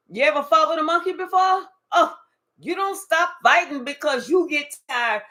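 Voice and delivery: usual speaking voice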